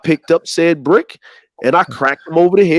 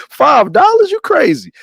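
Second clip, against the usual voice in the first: incredulous; 11 semitones higher than usual. Retold or imitated. Quoting himself at cousin.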